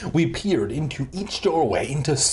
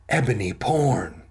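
gasp; 3 semitones lower than usual. Acted. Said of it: "I picked it up and gasped".

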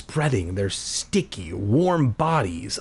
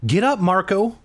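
higher-pitched motherly command; ordering mother; 8 semitones higher than usual. A narrator's reading. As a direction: order your kid to get up. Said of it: "Mom ordered".

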